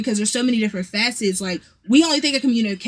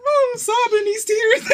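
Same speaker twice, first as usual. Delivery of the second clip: laughing mock sob; 10 semitones higher than usual. Retelling crying on the phone.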